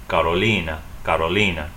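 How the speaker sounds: "Carolina" as kah-roh-LEE-nah, tapped R; Spanish pronunciation. Shown as a demonstration. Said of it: "In Spanish, they actually stress the same syllable".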